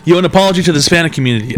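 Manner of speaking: normal talking voice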